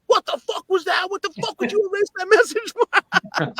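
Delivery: very high, screechy mock fury; mock outrage. Singer ripping on the fan about the erased message, mock outraged. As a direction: rip into him, metal-scream style.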